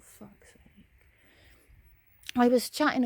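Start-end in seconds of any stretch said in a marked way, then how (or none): 0.0-1.0 breathy under-the-breath whisper; whispered thought. Real, not acted. Thinking it while the woman offers unwanted help, whispered. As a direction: whisper it so she can't hear.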